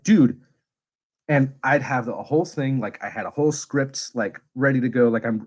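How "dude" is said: sharp, high, louder "Dude" of exasperation; exasperated dude. Retold or imitated. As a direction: snap out a fed-up "Dude". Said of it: "And I'd be like".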